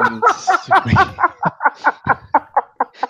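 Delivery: high burst of laughter. Real, not acted. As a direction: laugh out loud. Laughing at back spasm excuse.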